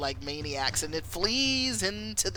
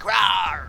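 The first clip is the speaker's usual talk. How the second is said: loud imitated big-cat roar; panther roar; 2 semitones higher, 7 dB louder than usual. Panther swiping at the raised voices, mock roar. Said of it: "the panther roars".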